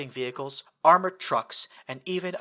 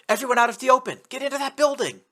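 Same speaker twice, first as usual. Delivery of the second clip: high, loud urgent yell; 11 semitones higher, 6 dB louder, faster than usual. Acted. Narrator yelling to the team under fire. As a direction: shout the team into cover. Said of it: "Then I yelled".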